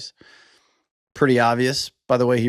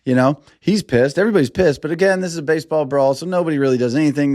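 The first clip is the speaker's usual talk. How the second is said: usual speaking voice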